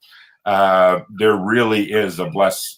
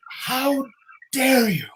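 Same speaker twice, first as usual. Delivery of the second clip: retold yell; slower than usual. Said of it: "I yelled out".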